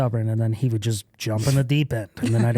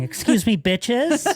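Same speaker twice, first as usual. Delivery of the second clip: elegant sassy put-down; 9 semitones higher, slower than usual. Retold or imitated.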